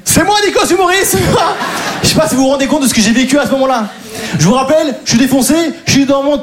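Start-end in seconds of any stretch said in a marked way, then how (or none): 0.0-1.5 high-pitched, laughing insistence; comic squeal of disbelief; mock indignant protest. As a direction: protest your identity way up high. Acted protest to the policewoman.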